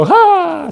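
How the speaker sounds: high mock laughing burst; imitated laugh. Teacher acting out his own contagious laughing fit, high mock laugh. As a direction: burst out in a pretend guffaw. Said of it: "그럼 나도 웃어".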